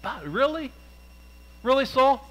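high, disbelieving Really, Saul; incredulous. Acted. Preacher as Samuel, doubting Saul's excuse, incredulous.

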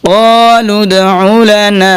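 chanted recitation; slow melodic recitation. Shown as a demonstration. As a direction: lift and stretch the phrase. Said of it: "নুন আলিফ জবর না".

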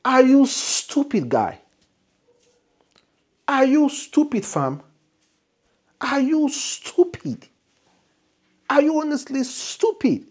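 high, loud, scornful divine voice; a mocking heavenly voice scolding the fool; voice from above. The angel's voice mocking the speaker, high and scornful. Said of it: "he repeated".

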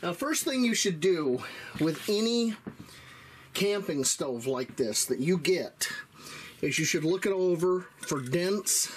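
ordinary delivery

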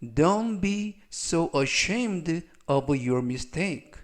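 slow, carefully spaced English sentence; slow model reading. Shown as a demonstration. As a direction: read each word slowly for learners. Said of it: "즉, 전체적 의미는".

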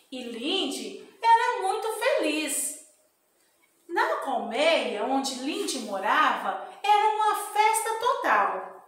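lively storybook narration; reading to children. Acted. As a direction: read the bee story to kids.

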